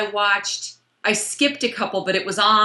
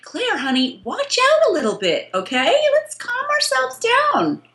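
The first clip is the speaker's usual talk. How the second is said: high, coaxing mock concern; mock soothing; 11 semitones higher than usual. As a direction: talk her down sweetly, drawing out the end. Pretend advice to a character.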